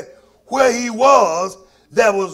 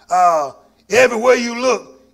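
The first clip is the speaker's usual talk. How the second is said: ordinary talk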